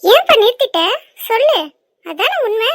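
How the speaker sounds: high, forceful challenge; cross mother demanding the truth; angry confrontation. Acted. Mother confronts her hesitating son.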